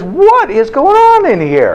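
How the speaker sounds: high, drawn-out mock outrage; exasperated wife. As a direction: imitate a baffled wife demanding answers. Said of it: "My wife comes back down and she says".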